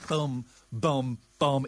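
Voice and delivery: sung drum sting, boom boom boom; imitated sting. Shown as a demonstration. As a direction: mouth the show's closing sting. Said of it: "it needs that little sting at the end".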